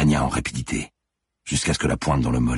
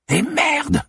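very high, screamed curse; furious, high-pitched yell; frustrated cry; 4 dB louder than usual. A narrator's reading. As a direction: scream a curse into empty air. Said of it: "cria-t-elle dans le vide".

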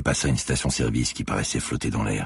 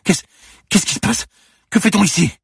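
stammering, much higher and louder in fright; panting panic; 8 dB louder than usual. Acted. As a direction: blurt it out, breathless and scared.

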